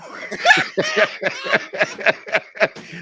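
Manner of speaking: high breathy burst of laughter; laughing. Real, not acted. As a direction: laugh out loud at the memory. Laughing at the story.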